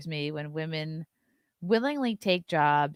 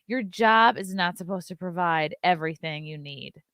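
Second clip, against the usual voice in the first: ordinary talk, nothing marked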